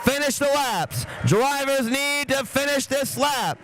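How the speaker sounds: excited race countdown, high and drawn out; high-pitched commentator's crowd-rousing yell. Real, not acted. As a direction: yell it out like an announcer at the finish. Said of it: "Sorry to the chat for yelling".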